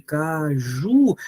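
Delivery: Caju with a lengthened JU; ca-JUU, drawn out at the end; stress on JU. Shown as a demonstration. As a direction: lean on the last syllable of Caju. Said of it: "A sílaba mais forte é essa aqui".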